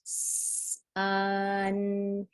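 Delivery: long hissed S gliding into a held, level vowel; really slow. Shown as a demonstration. Teacher giving students a word to blend, really slow. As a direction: stretch every sound out evenly. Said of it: "elongating those sounds".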